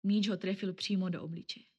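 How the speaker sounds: plain reading voice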